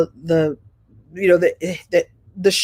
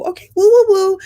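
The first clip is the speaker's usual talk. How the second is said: much higher, loud sing-song woo; Sinclair's woo; 11 semitones higher, 6 dB louder than usual. Retold or imitated. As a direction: let the woo ring out bright and high. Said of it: "Have Sinclair say woo".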